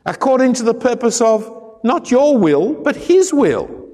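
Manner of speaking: his will rises high and slows; preacher's climactic contrast on his will; stress on HIS will. Acted. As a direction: hit "his" against "your".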